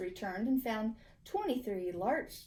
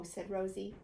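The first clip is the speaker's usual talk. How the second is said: ordinary delivery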